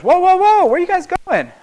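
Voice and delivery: high, loud whoa, stop them; alarmed shout. Retold or imitated. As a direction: call out to stop intruders. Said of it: "the woman goes".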